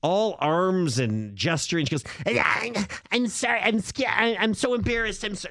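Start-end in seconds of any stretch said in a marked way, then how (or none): 2.2-5.5 higher, breathless rush of apologies; frantic embarrassed stranger. Retold or imitated. To the listener, imitating the gas station woman, frantic and higher. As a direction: play the flustered woman begging at the pump. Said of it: "this is exactly how she was talking".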